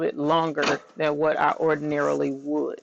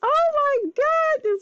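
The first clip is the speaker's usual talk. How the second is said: high, stretched squeal; real fangirling. Real, not acted. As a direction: ecstatic fan squeal. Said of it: "I am so excited".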